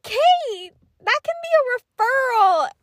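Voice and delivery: high-pitched mock teacher voice; imitating scolding teacher. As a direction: put on a high voice for the teacher's warning. Teacher scolding in a retold quote.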